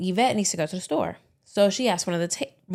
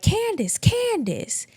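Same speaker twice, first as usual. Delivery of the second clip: much higher pleading call, falling; old man calling out; 14 semitones higher, much slower than usual. Retold or imitated. Theodore calling for his caretaker, high pleading. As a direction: cry her name up high, helpless. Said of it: "hearing Theodore be like".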